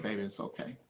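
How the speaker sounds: mother's hushed, gentle reassurance; soft voice. Retold or imitated. Son voicing his dying mother comforting him, hushed and tender. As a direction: soften right down to near hush, tender. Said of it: "In her soft voice".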